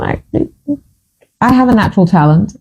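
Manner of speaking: ordinary talk, nothing marked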